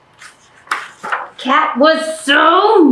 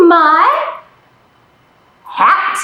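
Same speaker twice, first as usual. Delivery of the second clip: ordinary talk, nothing marked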